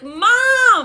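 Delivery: high, drawn-out mock yell; screaming for mom. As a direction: call out for mom in a reenacted wail. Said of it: "I'm screaming for my mom".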